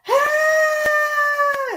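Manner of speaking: high shouted cry of pain. Retold or imitated. Peter Quill crying out in pain, a high shout. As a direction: yelp as if hurt. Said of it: "And he's like".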